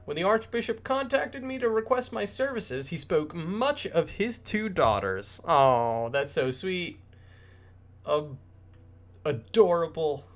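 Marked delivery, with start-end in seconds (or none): 0.0-5.4 higher, flattering merchant; lightly voiced eager trader; courteous trader. Matthias the trader flatters Sister Ophelia, higher and courteous.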